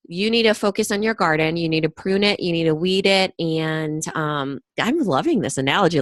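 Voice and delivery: ordinary delivery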